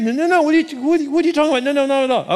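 high, loud, stammering denial; hammed-up indignant objection; flustered protest. Preacher as Hannah denying she was drinking, high flustered protest.

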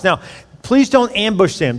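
ordinary talk, nothing marked

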